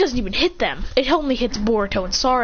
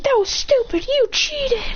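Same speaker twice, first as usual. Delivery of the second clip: mock yelling; 13 semitones higher, slower than usual. Adults yelling at the young winner, mock angry shout. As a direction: imitate an outraged crowd complaining. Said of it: "he's getting yelled at".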